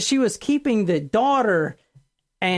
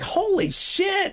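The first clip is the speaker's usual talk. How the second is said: stunned reaction; 11 semitones higher, slower than usual. Retold or imitated. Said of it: "And everyone's just like".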